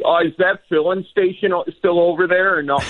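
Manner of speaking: high, curious Wisconsin local; chatty hometown Wisconsin accent. Acted. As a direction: quiz them in a lifted Wisconsin small-town voice. Acting out locals comparing hometowns.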